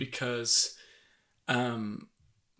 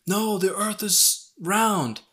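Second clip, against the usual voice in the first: mock protest yell; 8 semitones higher, slower than usual. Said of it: "no one is standing up yelling".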